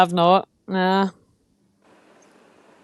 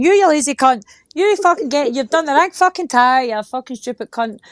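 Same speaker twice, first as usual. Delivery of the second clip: raised-pitch, louder matey abuse; mock scolding; 4 semitones higher, 4 dB louder than usual. Berating a mate over a wrong tyre, mock scolding. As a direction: rattle off a retold telling-off.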